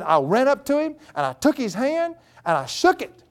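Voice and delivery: very high, thrilled retelling; overexcited daughter. Retold or imitated. Daughter telling dad about shaking his hand, overexcited. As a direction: imitate a daughter bubbling over, pitch soaring. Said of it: "how joyful she was and overexcited".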